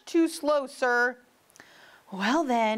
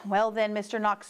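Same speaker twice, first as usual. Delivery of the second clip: plain reading voice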